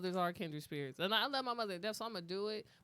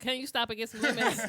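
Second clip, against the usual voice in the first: mom voice; 4 semitones higher, 9 dB louder than usual. Retold or imitated. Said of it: "be like".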